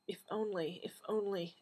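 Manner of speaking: soft anguished whisper. Acted. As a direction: breathe it out in despair. Doctor's anguished murmur.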